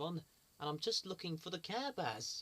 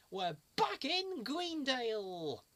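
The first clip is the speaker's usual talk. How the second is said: much higher, slow big announcement; excited; 7 semitones higher, slower than usual. Acted. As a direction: proclaim it like a hero.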